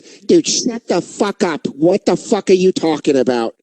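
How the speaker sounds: lightly voiced irritated comic cutting in; a hint of a gruff heckling friend; Bobby Kelly. Comic being toasted cuts off the tribute, mock irritated impression. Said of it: "toasting Bobby Kelly going".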